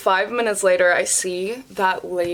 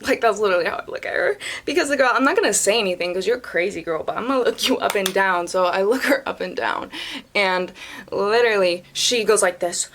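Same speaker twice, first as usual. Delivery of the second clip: normal talking voice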